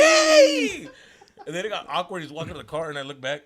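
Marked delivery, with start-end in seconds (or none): 0.0-0.9 very high, loud comic squeak; voice jumping an octave. Retold or imitated. Punked son saying okay to dad, comically high loud squeak. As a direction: shriek it up top, mocking your own cracked voice. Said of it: "go a different octave and then try to act like".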